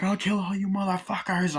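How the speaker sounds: a crazed man threatening everyone; mock threat.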